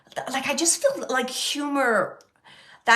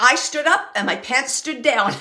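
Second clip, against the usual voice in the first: louder jokey retelling; funny quote; 3 semitones higher, 7 dB louder than usual. Retold or imitated. Medium quoting the funny gentleman, loud and amused. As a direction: toss out his punchline loudly. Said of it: "funny, funny gentleman".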